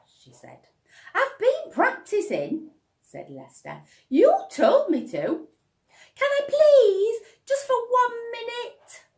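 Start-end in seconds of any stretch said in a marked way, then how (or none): 1.1-2.7 put-on much higher, louder boy's voice; an eager little boy asking; eager child. Lester pleading. 4.1-9.0 put-on much higher, louder boy begging; pleading child. Lester pleading.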